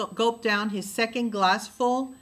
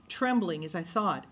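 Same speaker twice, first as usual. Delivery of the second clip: plain reading voice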